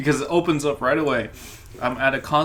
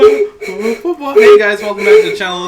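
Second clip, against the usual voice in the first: big loud announcer intro; radio host voice; 12 dB louder, slower than usual. Pretend. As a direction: boom it out like a radio DJ. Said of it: "It's a radio program".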